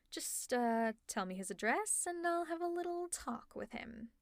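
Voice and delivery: ordinary talk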